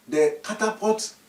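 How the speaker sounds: plain reading voice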